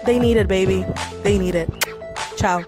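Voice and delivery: ordinary talk, nothing marked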